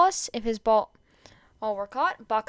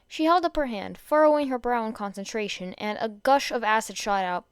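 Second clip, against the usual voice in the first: ordinary delivery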